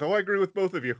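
lightly hammed-up agreeableness; mock agreeable. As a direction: breeze past the quarrel, playing the go-between. France placating both quarrelling countries.

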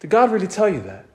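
quick, a touch of doubt in Eve's voice; Eve questioning. Pretend. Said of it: "And then Eve says".

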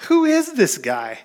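high, slow, amazed question; awestruck disciples. Retold or imitated. Disciples after the storm asking in high astonishment. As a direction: ask it in wide-eyed wonder. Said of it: "they said the same thing".

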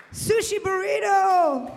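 high, slow, delighted shout; excited shout. Retold or imitated. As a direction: yell it like a thrilling discovery. Mimicking immigrant parents' excitement.

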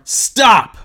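high, loud one-word command; yelling at the storm. Acted. Jesus standing in the boat ordering the storm, acted yell. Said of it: "and yells at the storm".

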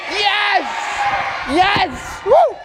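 high shouted cheers; excited cheering. Real, not acted. Host cheers with kids.

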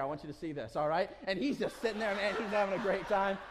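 normal talking voice

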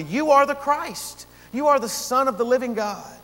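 bold, lifted confession of faith; unequivocal declaration. Acted. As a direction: declare it with full conviction. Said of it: "Peter declaring unequivocally".

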